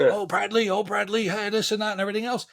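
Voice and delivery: high, gushing mock flattery, fast; fawning sycophants. Mocking the crowd fawning over Brad, high and gushing. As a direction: ham up the brown-nosers fawning over him.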